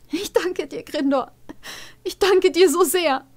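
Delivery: put-on high, loud girlish thanks; bursting with joy; overjoyed Farah. Farah thanks her old teacher, high and overjoyed.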